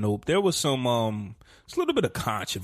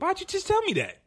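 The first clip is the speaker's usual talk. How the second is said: much higher, indignant complaint; outraged squeal. Real, not acted. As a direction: protest the spoiler, pitch way up. Spoiler complaint to friend.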